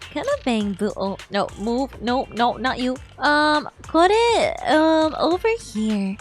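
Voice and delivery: ordinary talk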